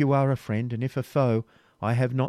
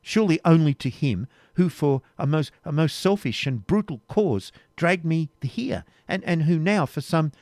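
higher, impassioned reproach; indignant accusation; 7 semitones higher, 5 dB louder than usual. Acted. Rebecca accusing the Templar who dragged her here, impassioned. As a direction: hurl the blame back at him.

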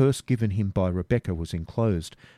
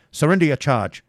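commanding robber; 6 semitones higher, 7 dB louder than usual. Acted. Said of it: "said one of them".

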